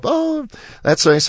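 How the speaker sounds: high, slow, offhand retold reply; imitating teacher's reply. Bob mimics his teacher brushing off praise, high and slow. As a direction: drawl it up high, unimpressed.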